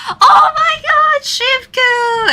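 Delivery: very high, slowed, delighted fangirl squeal; high-pitched gasp of fan joy; excited squeal. Retold or imitated. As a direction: shriek with joy at a matching plushie. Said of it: "so I was like".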